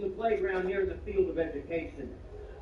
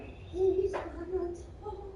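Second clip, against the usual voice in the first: much higher, very slow, stunned voice; hammed-up classmates gasping in awe; mock amazement; 13 semitones higher, much slower than usual. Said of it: "people are like".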